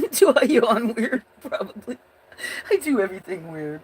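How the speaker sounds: giggling, high questions; laughing at herself. Real, not acted. Streamer laughing about her weird yawn, giggling.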